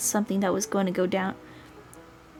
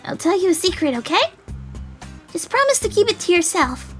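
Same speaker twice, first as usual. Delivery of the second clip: put-on high girlish secret-sharing; conspiratorial, high-pitched girl; confiding dandelion girl; 9 semitones higher than usual. Girl offers to share a secret.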